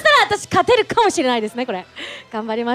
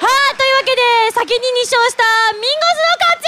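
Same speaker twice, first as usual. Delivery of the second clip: announcer voice; 6 semitones higher than usual. Real, not acted. Announcing winner to crowd.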